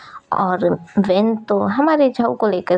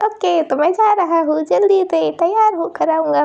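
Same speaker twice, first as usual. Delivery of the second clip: put-on much higher, eager child voice; happy excited child; 9 semitones higher than usual. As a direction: bubble over with joy about going out. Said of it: "चैंगलिंग खुश हो जाता है".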